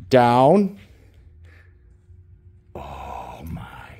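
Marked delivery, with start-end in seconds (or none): 2.8-4.0 breathy whispered oh my. Real, not acted. Owner keeping her dog in a stay, whispering. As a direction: whisper it so the dog stays put.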